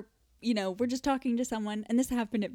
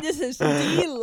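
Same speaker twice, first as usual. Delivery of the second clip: louder, higher "deluxe" with a long DEE; drawn-out deluxe; 5 semitones higher, 8 dB louder than usual. Retold or imitated. Repeating own silly food praise, exaggerated drawn-out "deluxe". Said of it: "that was so stupid".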